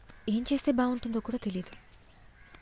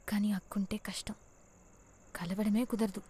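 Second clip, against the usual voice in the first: whisper. Acted. Girlfriend secretly whispering to her lover on a night call. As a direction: breathe the words so no one hears.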